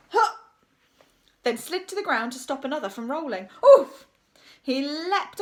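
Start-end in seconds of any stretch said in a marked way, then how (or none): 0.1-0.4 sharp huff of a leap; effort grunt. A narrator's reading. Effort noise of leaping teacher. 3.6-4.1 loud, high oof; impact grunt. A narrator's reading. Teacher slides to the ground.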